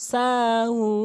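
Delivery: সাহুন with a long held "hoon" at the stop; three-alif stretch. Shown as a demonstration. Teacher shows stretching at a pause, long sustained chant. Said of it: "ওয়াকফ যখন করবেন অবশ্যই তিন আলিফ টানবেন".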